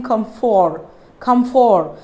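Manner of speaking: "comfort" as cum-FOR, O sounded; "comfort" ending in a clear for; wrong pronunciation. Shown as a demonstration. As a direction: voice a full FOR in "comfort". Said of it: "So I'm not saying".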